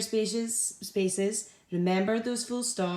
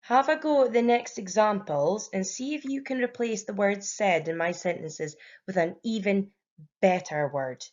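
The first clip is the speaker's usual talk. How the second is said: normal talking voice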